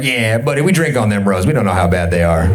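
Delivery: lightly voiced Southern governor, bragging; Louisiana governor. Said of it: "Louisiana governor's like".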